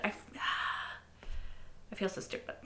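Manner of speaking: unvoiced, sighing it quickly; sighed whisper. Real, not acted. Deflated viewer sighing to herself after the reveal. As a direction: breathe it out in defeat.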